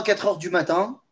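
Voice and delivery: ordinary talk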